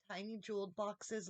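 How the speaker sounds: ordinary delivery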